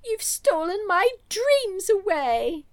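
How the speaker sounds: high, slow melodramatic lament. Retold or imitated. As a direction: ham up the tragic line. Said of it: "wait for it".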